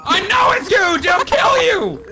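high, loud panicked yell; alarmed shout. Acted. Host yelling at a friend about to eat concrete, panicked high shout. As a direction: shout him away from the danger.

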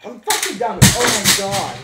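frustrated yell. Real, not acted. Streamer yelling at the game in frustration. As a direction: blow up at the stupid game.

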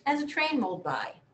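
ordinary delivery

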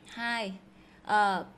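ordinary talk

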